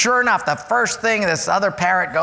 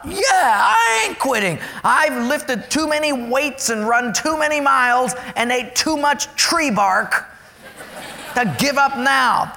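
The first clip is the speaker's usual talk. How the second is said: high, slow and resolute; a touch of a runner's grit; determined inner voice; 7 semitones higher than usual. Retold or imitated. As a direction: refuse to quit, teeth set. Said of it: "I thought".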